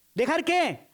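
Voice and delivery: ordinary talk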